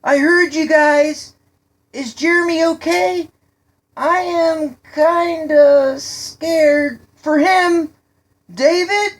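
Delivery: high, loud, drawn-out shouts; anxious calling out. A narrator's reading. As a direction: call into the smoke for lost friends. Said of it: "He called out".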